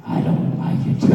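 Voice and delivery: rough, airy, dismissive grumble; gruff Vince. Retold or imitated. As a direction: brush the idea off bluntly. [name] voices a dismissive Vince.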